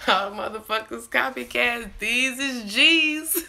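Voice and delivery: high, loud, laughing brag; laughing boast. Real, not acted. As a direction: crow it through laughter.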